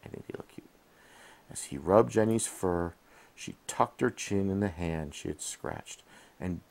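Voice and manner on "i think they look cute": soft whispered aside. Real, not acted. Aside to child while reading.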